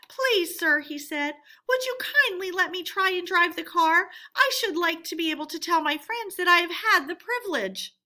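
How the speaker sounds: high, ingratiating plea; butter up the driver; wheedling Toad. A narrator's reading. Toad asking the driver to let him drive, high and coaxing.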